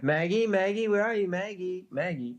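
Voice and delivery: high, loud calling for Maggie; husband searching the house for his wife, calling out. Acted. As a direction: call her name through the house, worried.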